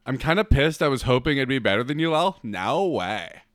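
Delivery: normal talking voice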